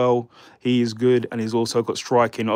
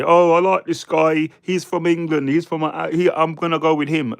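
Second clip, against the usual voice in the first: higher mocking voice of a fan-boy tipster; lightly voiced, a sneer at rival pundits; mock biased tipster; 4 semitones higher than usual. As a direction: send up the channels that back their mates. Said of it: "like a lot of other channels do".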